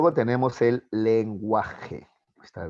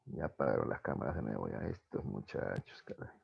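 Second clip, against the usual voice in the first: grumbling aside; 12 semitones lower, 12 dB quieter than usual. Real, not acted. Teacher grumbles students switched cameras off.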